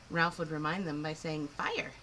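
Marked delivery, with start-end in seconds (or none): 1.5-1.9 much higher squawked fire; parrot squawk. Acted. Parrot Ralph reminding the Muggses, high squawk. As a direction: screech the word like a parrot.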